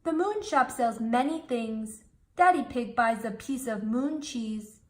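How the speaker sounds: usual speaking voice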